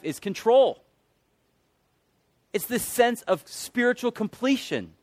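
loud, high, emphatic proclamation; full-force preaching. Real, not acted. Preacher confessing his idol of control, full-force preaching.

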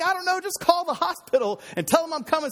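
high-pitched frantic retort; mock panic. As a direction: act out a flustered husband giving urgent orders. Retold panicked reply to wife.

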